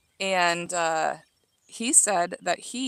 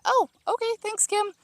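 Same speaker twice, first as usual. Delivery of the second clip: surprised; 14 semitones higher than usual. Real, not acted. Reacting to Kim's comment.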